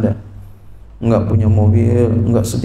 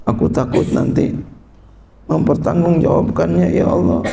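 mock sad prayer; 4 semitones higher than usual. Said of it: "Sedih dia".

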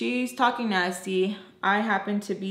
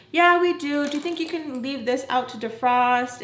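high-pitched imitation of her; mock employer voice; 5 semitones higher than usual. Employer giving the cleaner chores, mock high voice. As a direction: mimic her handing out chores. Acting out the teacher's answer.

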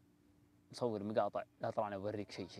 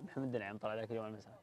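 ordinary delivery

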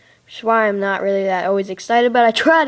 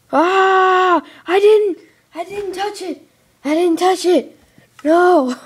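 panicked denial; 10 semitones higher, 8 dB louder, slower than usual. Real, not acted. Block breaks by accident.

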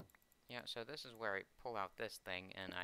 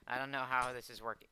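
ordinary talk, nothing marked

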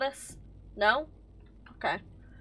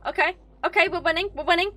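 raised, louder repeated chant; keyed-up winning chant; excited self-hyping; 5 semitones higher, 5 dB louder than usual. Real, not acted. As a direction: repeat it higher, like cheering yourself on.

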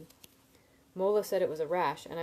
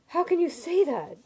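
high, loud horrified demand; 11 semitones higher, 8 dB louder than usual. A narrator's reading. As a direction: cry it out in horror. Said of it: "he demanded, plainly horrified".

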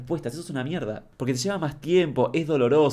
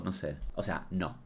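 much softer, lower aside; barely audible aside; muttered aside; 7 semitones lower, 12 dB quieter than usual. Real, not acted. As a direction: mumble it to yourself.

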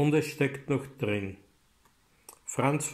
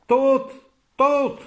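a piercing scream, much higher and louder; 13 semitones higher, 9 dB louder than usual. A narrator's reading. Anna crying out at her brother's death, screaming. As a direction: shriek the word in despair. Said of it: "schrie Anna".

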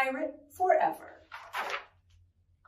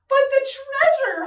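high protesting holler; 13 semitones higher, 4 dB louder than usual. Acted. Captain protests turning back with treasure aboard, hollering. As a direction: holler your objection over the storm. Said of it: "hollered Braidbeard".

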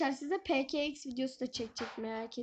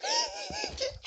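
sharp high gasp of fright; frightened high gasp; 3 dB quieter than usual. Real, not acted. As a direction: gasp in sudden panic. Drops item, panics.

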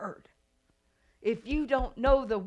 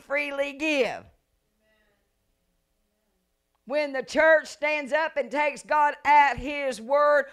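preaching; 5 semitones higher, 4 dB louder than usual. Acted. Preacher exhorting the church to take God at his word. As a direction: drive it home to the congregation.

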